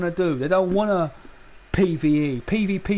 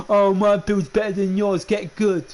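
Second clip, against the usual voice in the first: mocking toxic gamer; 2 semitones higher, 3 dB louder than usual.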